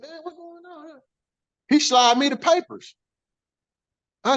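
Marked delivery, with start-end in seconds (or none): 0.0-1.0 very quiet, high-pitched aside; hushed aside. Retold or imitated. Man reacting to his union rep going quiet, hushed. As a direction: wonder it under your breath, much softer.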